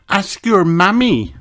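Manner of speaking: put-on high little girl voice; a small girl telling her friend; Susie's voice. A narrator's reading. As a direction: read aloud as a child character. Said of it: "insisted Susie".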